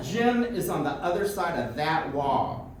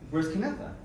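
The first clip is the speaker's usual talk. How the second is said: ordinary talk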